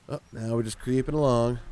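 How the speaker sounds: ordinary talk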